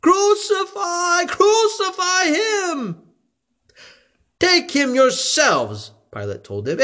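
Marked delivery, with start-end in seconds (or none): 0.0-3.0 high, slow, crying yell; crowd shouting. A narrator's reading. The crowd demanding crucifixion, a high drawn-out yell. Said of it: "They shouted". 4.4-5.9 higher, slow dismissive retort; Pilate retorting. A narrator's reading. Pilate to the priests, higher and slow.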